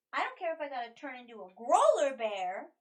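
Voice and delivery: loud, determined declaration; defiant outburst. Acted. Adventurer insists on fighting despite being doubted, defiant outburst. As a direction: insist on it loudly, leaning on growler.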